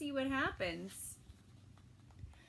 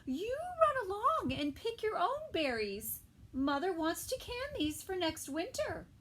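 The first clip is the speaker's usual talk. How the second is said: much higher, sing-song mom; high motherly voice; 7 semitones higher than usual. Acted. Sal's mother talking to her child, sing-song high.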